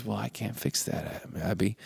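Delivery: very soft, hushed reply; gentle angel. Retold or imitated. Angel telling Abby he can't fix it, gently hushed. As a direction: let a child down softly.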